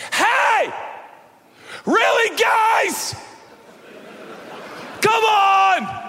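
high, loud, fed-up shout; exasperated shout. Retold or imitated. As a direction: yell it like a fed-up coach scolding. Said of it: "show up on the scene and say".

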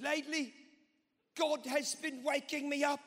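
high-pitched, piratical Australian preacher; Christine Caine. Retold or imitated. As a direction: mimic a pirate-sounding Australian woman preacher. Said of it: "she sounds like a pirate".

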